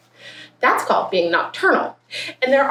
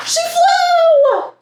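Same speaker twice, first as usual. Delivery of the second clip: very high, loud triumphant cry; exultant storybook shout; triumphant climax; 5 dB louder than usual. A narrator's reading. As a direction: let it soar up as the big payoff.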